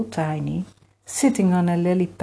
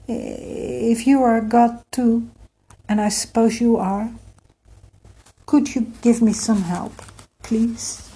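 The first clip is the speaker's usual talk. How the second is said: higher-pitched, tentative courteous request; polite; 6 semitones higher, 3 dB louder than usual. A narrator's reading. Emir asking the frog god for help, polite. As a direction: ask politely, with careful pauses. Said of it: "said politely enough".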